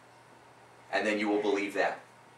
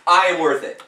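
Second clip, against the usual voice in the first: loud affirmation; 6 semitones higher, 11 dB louder than usual. Shown as a demonstration. Coach leading client in affirmation, loud and high. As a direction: punch out I am worth it, strong and high. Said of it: "Go like this".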